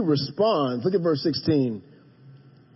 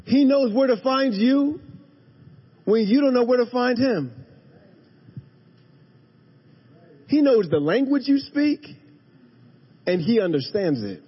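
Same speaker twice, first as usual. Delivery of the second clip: rising preaching; 4 semitones higher than usual. Real, not acted. Preaching climax.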